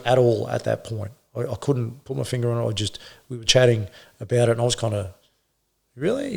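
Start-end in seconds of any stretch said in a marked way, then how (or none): 6.0-6.3 a very high, short, rising really; a hint of surprise at a colleague's plan; surprised really. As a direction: shoot the pitch up in disbelief. Incredulous reply to a colleague.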